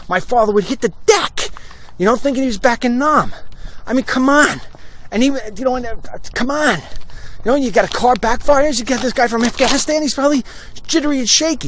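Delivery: high-pitched, exasperated appeal; indignant pleading. Real, not acted. Host defending a jittery veteran, high-pitched and exasperated. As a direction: argue it high and worked up.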